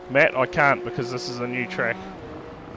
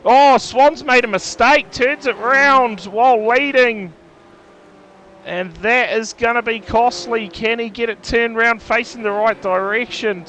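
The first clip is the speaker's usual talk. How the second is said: higher, louder race call; excited commentary; 6 semitones higher, 6 dB louder than usual. Real, not acted. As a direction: call the spin with real excitement. Leader spins, race lead changes.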